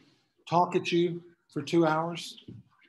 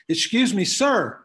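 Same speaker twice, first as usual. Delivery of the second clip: a pilot shouting down to a farmer below, louder and higher; calling out; 4 semitones higher, 6 dB louder, slower than usual. Acted. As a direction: call down loudly and higher from the balloon, politely. Pilot calls down from balloon.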